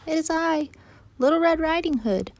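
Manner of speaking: put-on very high, sing-out child voice; little girl. Girl calls to grandmother at the door, high childlike voice. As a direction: announce yourself like a small girl.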